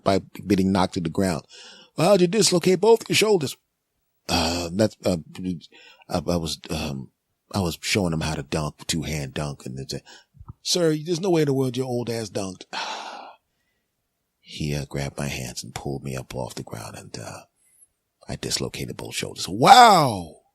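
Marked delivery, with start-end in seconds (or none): 2.0-3.6 paramedic. Acted. Paramedic asking. 4.3-19.5 much softer, lower sheepish admission; embarrassed mumble. Acted. Embarrassed dad admitting dunk.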